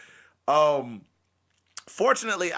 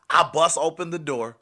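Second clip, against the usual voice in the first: ordinary talk, nothing marked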